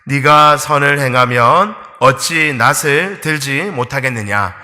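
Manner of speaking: slow, loud unison scripture reading; congregation reading aloud. Shown as a demonstration. Pastor leads the church reading the red verse aloud together. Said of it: "같이 한번 읽어보겠습니다".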